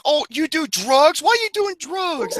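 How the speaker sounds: high-pitched nagging impression of movie mothers; hammed-up high scolding; mock scolding relatives. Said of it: "They're like".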